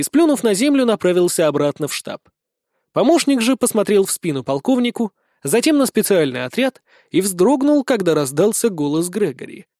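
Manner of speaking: usual speaking voice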